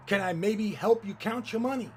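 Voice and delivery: loud, gushing mock service; imitating an over-helpful server; effusive courtesy. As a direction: fawn over a favoured customer. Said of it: "Never such effusive courtesy".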